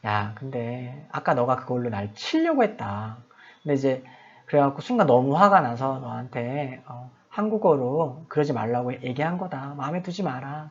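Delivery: low, soft retold apology to a kid; hushed reconciling talk; gentle explaining. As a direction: explain quietly to make peace. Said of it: "이게 설명을 해주는 거죠".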